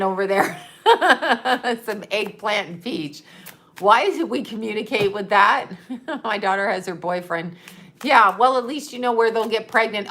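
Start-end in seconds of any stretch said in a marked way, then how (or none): none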